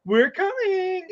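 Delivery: very high, eerie wailing impression; Bray Wyatt. Pitching a storyline to co-host, high wailing wrestler voice. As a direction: call it out in a high, drawn-out character voice. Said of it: "And he's like".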